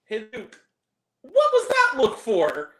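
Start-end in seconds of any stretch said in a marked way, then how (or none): 1.2-2.7 mock indignant. Real, not acted. Teasing friend Luke.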